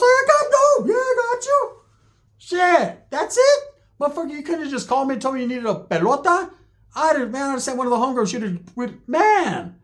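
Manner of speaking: very high, squealing mock outburst; exasperated relief. Narrator learning homie only wanted dough, exasperated relief. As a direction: burst out high, half relieved, half indignant.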